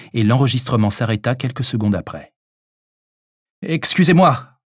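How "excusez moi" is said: raised, higher voice of a doctor shouting out; calling out loudly. A narrator's reading. Doctor shouting to a stranger behind a steel door, calling out. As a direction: call out to someone unseen. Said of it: "cria le docteur".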